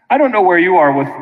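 ordinary talk

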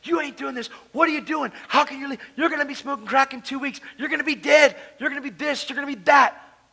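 lightly voiced, panicky counsellors; alarmed staff. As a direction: imitate the staff freaking out at him. They're like.

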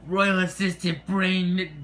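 shouted royal summons; calling out. Acted. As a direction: call the assistant from across the palace. King calls his assistant.

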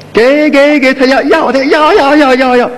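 put-on high, sing-song coaxing of a baby; 逗小孩的高调哄声; baby-talk to a toddler. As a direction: play peekaboo with a one-year-old, voice way up. Acting out offering toy to baby.

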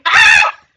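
very high, loud scream of shock; a piercing cry of dismay. Real, not acted. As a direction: shriek at the sudden letdown. Sudden bad surprise in game.

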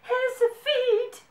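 high, loud panicked yell. A narrator's reading. Dakota mistakes carrots for his feet, yelling. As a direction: yell out in storybook horror. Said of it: "yelled Dakota".

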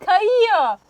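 high, loud exasperated wail; frustrated cry. Real, not acted. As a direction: yell at the slipping food. Food slipping again.

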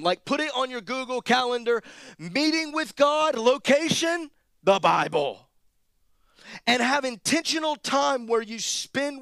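high, loud, driving sermon delivery; impassioned preaching. Real, not acted. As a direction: preach it hard to the whole room. Preaches at full force.

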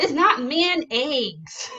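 mayonnaise mocked as "man eggs", high and loud; mocking mispronunciation. Kids tease their parent's way of saying mayonnaise, mocking mispronunciation. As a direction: loudly exaggerate the wrong "man eggs", drawn out. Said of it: "they're like".

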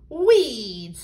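loud, indignant outburst; furious beetroot. A narrator's reading. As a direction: spit out the word in outrage. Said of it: "the beetroots were furious".

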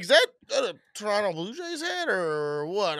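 lightly hammed-up stranger; mocking the stranger who asked about the hat; mock creepy stranger. Mimicking creepy older stranger.

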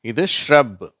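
ஷ்ரப் with sh and r merged into one onset; teacher modelling ஷ்ரப் for learners; model word shrub. Shown as a demonstration. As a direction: say ஷ்ரப் slowly and clearly as a model. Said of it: "இதை நான் வாசிக்கிறேன்".

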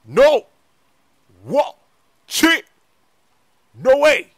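loud, high yelps of disbelief; shocked outburst. Acted. Friend first sees [name]'s baby, shocked shouting. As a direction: burst out stunned, one word at a time.

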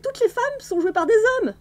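high, pleading little voice; pitiful. A narrator's reading. Magra pleading with Granny, high and pitiful. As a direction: whimper it out, ashamed and pleading. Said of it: "d'un ton pitoyable".

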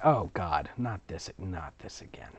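ordinary delivery

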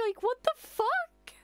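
high, slow, drawn-out what the fuck; can't believe what just happened; aghast outburst at the screen; stunned disbelief. Real, not acted. Shocked at a character's death.

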